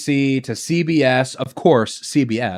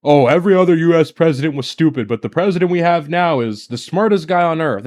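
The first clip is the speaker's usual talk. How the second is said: fast, lightly put-on fawning voice; mocking chud voice. A streamer mocks the vice president's praise of Trump, fast and fawning. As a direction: rattle it off like a smug fanboy. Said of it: "he has such a chud aura".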